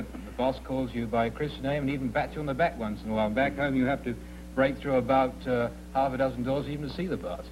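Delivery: ordinary delivery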